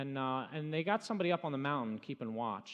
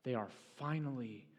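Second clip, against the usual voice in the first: hushed telling; 7 semitones lower, 6 dB quieter than usual. Acted. Preacher softly describes the Israelites' new freedom. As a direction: let the awe settle quietly.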